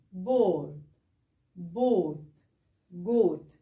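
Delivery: each word with a rounded o. Shown as a demonstration. Teacher modelling rounded o words for students. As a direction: round the lips on each vowel. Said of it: "تاني،".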